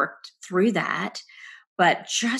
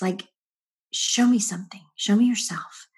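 whispered plea; 4 semitones higher, 3 dB louder than usual. Retold or imitated. Desperate prayer alone while driving.

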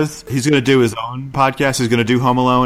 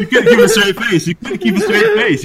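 laughing; 10 semitones higher, 4 dB louder than usual. Real, not acted. Teasing co-host, laughing.

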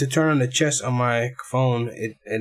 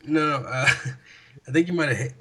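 ordinary talk, nothing marked

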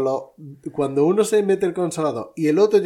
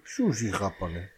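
lower, quiet, hurt correction; offended shopkeeper; 5 semitones lower, 5 dB quieter than usual. Retold or imitated. The shopkeeper, offended, correcting him in a low voice. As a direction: mutter it, wounded. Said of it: "la cara pena".

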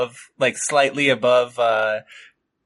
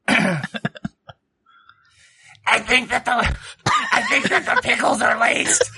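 whispered character voice; 10 semitones higher than usual. Acted. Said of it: "so that he's whispering into Emmy's ear".

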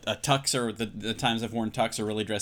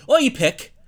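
loud, high-pitched Irish accent; 14 semitones higher, 10 dB louder than usual. Shown as a demonstration. Co-host guesses the Irish restaurant name, mock Irish accent. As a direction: put on a big playful Irish accent. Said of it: "If I were doing an Irish accent".

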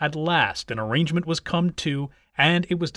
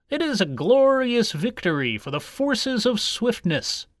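higher, declaiming turtle voice; pronouncing turtle; 6 semitones higher than usual. A narrator's reading. Turtle asked what the achievement signified, proclaiming. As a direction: deliver it as a grand verdict.

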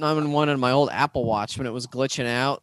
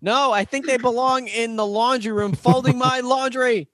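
slow, higher mock-chauvinist line; deadpan provocative joke; 8 semitones higher than usual. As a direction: deliver the bait slowly, poker-faced. Deliberately provocative joke answer.

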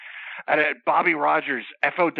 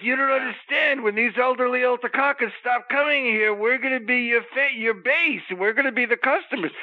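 friend pleading; 9 semitones higher than usual. Retold or imitated. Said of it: "And he's like".

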